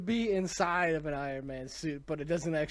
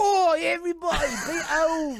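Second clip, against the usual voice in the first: much higher and louder mock Australian accent, laughing through it; hammed-up Australian accent; 14 semitones higher, 8 dB louder than usual. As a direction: squeal a silly Australian Oi, cracking up. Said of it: "That's not good either".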